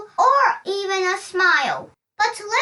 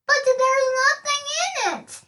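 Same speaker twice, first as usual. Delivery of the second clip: exclaimed; 6 semitones higher than usual. A narrator's reading. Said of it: "she exclaimed".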